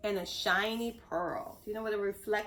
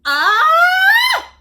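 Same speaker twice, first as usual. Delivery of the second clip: very high, very loud scream; little fish shrieking at a shark; terrified shriek; 17 dB louder than usual. Acted. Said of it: "Stanley shrieked".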